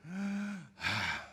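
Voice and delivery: long sighing exhale after drawing breath in; the four letters pronounced as breath; breathing the name. Shown as a demonstration. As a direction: breathe in, then let the name out as a sigh. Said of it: "Esa es la pronuncia de esas cuatro letras".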